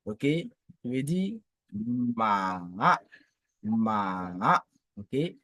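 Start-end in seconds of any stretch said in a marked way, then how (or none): 1.7-2.6 MA drawn out slowly, dipping down then rising; dipping third tone. Shown as a demonstration. Teacher showing the troisième ton, long MA falling then rising. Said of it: "le ton descendant, ascendant". 3.6-4.6 MA drawn out slowly, dipping down then rising; dipping third tone. Shown as a demonstration. Teacher showing the troisième ton, long MA falling then rising. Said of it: "le ton descendant, ascendant".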